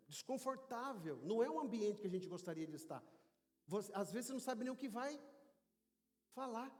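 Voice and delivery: ordinary delivery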